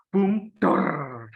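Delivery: put-on playful Japanese accent. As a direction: punch "Boom" in a Japanese accent. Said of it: "let me have my Japanese accent".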